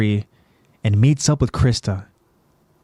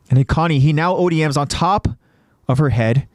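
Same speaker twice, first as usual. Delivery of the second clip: ordinary delivery, nothing marked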